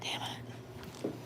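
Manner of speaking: damn it breathed out in a whisper; annoyed whispered curse. Real, not acted. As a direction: swear under your breath. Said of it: "cursing under breath".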